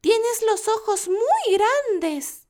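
put-on very high, slow wondering child; curious little girl. Girl asks the wolf.

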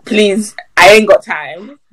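loud, emphatic protest; exasperated refusal. Real, not acted. Fed-up cook refusing to make two dishes, loud exasperation. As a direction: put your foot down loudly.

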